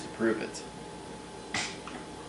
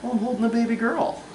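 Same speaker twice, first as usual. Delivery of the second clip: high voice; 10 semitones higher than usual. Real, not acted. Dad answers camera in baby voice.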